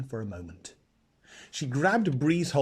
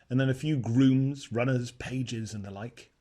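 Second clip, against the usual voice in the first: ordinary delivery, nothing marked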